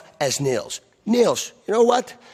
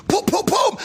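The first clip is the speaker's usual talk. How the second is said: high sing-song boom; punch sound effects. Retold or imitated. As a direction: chant the punches. Punch noises in brawl story.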